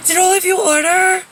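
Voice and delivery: woman skipping the line, hammed-up loud high voice; entitled lady. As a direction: play the clueless lady who skips the line. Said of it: "she's like".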